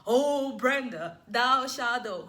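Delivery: loud, slow, mock thundering voice of God; a mock booming God she says it isn't, slow and loud. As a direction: boom it out like a movie God. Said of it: "It's not, like, loud".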